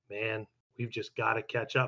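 low, soft, understated delivery; subdued Todoroki quote. Retold or imitated. Imitating Todoroki repeating himself to classmates, low and quiet. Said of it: "he's like".

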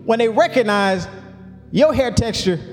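rising, building sermon cadence with long held words; fiery preaching. Acted. Pastor preaching to his church, climbing, full-force sermon delivery. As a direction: preach it out to the congregation, pitch climbing.

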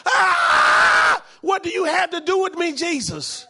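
high, loud demon cry; hollering demon. Retold or imitated. Demon in the synagogue crying out at Jesus, high shout. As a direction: let the devil shriek out at Jesus. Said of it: "start hollering out".

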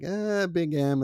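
a high, slow, doubtful "Eh"; doubtful reaction. Retold or imitated. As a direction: pull back, unsure of the name. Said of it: "I was like".

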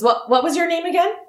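high, fast, apologetic gnome host; flustered old gnome. Acted. Wulfric asks Trigus his name.

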